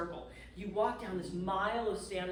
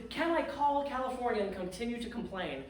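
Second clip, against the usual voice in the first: usual speaking voice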